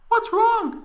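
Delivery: shrill falsetto question; high concerned Tifa. A narrator's reading. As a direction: ask in a squeaky girl's voice.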